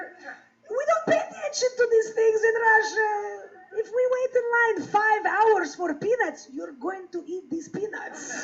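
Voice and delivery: put-on slow, loud Russian accent; drawn-out mock Russian scolding; Russian mother lecturing. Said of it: "And she says".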